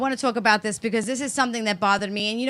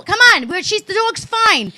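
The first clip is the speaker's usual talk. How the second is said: put-on high, louder kid urging someone along; imitating pushy girl; 9 semitones higher, 5 dB louder than usual. Young girl pulling the daughter to the dog, imitated high and insistent. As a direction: mimic an impatient child coaxing.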